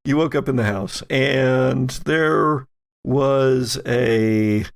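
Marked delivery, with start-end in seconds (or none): none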